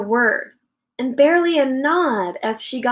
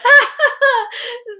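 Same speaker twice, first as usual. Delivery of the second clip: high, loud mocking laugh; big sister laughing at the haircut; burst of laughter; 13 semitones higher, 5 dB louder than usual. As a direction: laugh right at him. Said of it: "burst out laughing".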